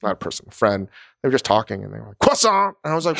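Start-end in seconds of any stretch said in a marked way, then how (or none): 2.2-2.8 CROISSANT hit hard as "kwah-sawn", pitch jumping up; CROISSANT popped out abruptly, final t dropped; overdone French pronunciation. Retold or imitated. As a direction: mimic a show-off saying CROISSANT in hard faux French. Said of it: "such a hard pronunciation of it".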